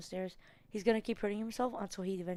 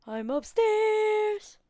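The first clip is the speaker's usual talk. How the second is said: high, slow sung-out yell; yelling across the room; 13 semitones higher, 13 dB louder, much slower than usual. Real, not acted. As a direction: call out long and loud, operatic. Calling monster from upstairs.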